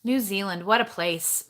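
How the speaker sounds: ordinary talk, nothing marked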